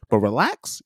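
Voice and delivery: ordinary delivery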